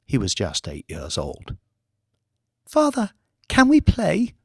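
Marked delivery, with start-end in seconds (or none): 2.7-4.3 put-on high small child's voice; boy asking his father to play; young boy. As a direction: ask like an eight-year-old. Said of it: "he had asked".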